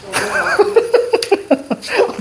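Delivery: high laugh. Real, not acted. Teacher laughing at the student's quick nod, high.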